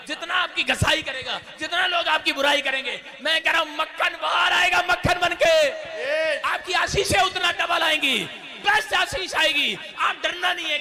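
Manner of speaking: high, impassioned revival shout; urgent, ringing preacher's cry; full-force preaching. Real, not acted. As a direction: fire up the congregation with every phrase.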